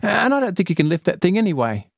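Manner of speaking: put-on doubtful girl. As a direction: tease the friend a little. Celia voiced as child.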